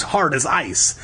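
ordinary delivery

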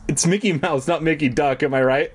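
ordinary delivery

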